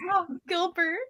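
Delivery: ordinary delivery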